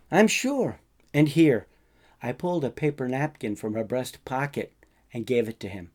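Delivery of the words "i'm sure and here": louder, pressing the offer; insistent. Acted. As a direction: push it on him firmly. Pushing sandwich and napkin on him.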